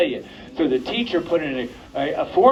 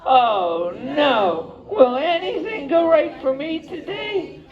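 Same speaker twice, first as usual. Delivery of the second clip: much higher, dramatic wail of bad luck; woeful lament; 9 semitones higher, slower than usual. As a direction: moan it like a sorry little cub. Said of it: "And he went".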